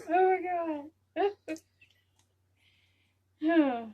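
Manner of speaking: loud drawn-out oh my God; loud stunned exclamation; loud exclaim. Real, not acted. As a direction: cry it out, stunned. Overwhelmed reaction.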